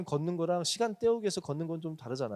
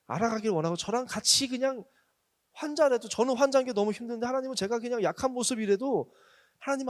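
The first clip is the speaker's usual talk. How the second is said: louder, higher fervent preaching; preaching at full force; 6 semitones higher, 5 dB louder than usual. Acted. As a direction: pour it out passionately from the pulpit. Preaching builds to fervent plea.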